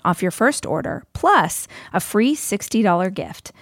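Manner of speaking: upbeat, punchy sponsor read, slowing on the offer. Acted. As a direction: sell the deal to listeners. Host reads sponsor ad.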